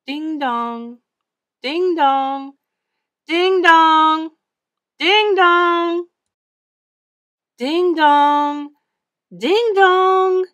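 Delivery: held, sung-out bell tones, swelling; church bells ringing. A narrator's reading. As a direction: peal like bells, growing nearer. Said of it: "like church bells ringing".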